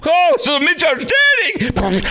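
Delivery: high, hammed-up innocent voice; mock breezy excuse. Host mocks the golfer brushing off police, high hammed-up innocence. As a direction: play the driver shrugging it off, high and hammed up.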